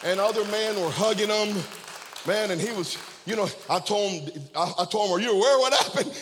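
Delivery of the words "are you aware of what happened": higher, louder eager question; excited question. Retold or imitated. Pastor asks the freed young man, excited and loud. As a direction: ask with wonder and excitement.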